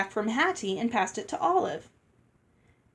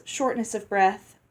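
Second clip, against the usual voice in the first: plain reading voice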